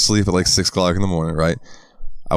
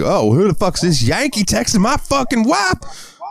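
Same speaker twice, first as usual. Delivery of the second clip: put-on higher, slower Southern accent; Southern husband; 14 semitones higher, slower than usual. Southern husband angry at Yankee texting wife, Southern accent. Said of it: "I don't sound Southern".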